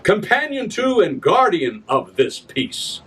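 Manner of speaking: grand stage recitation of a royal proclamation; theatrical verse reading. Acted. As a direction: proclaim it like a herald to the crowd. Reads his Shakespeare adaptation.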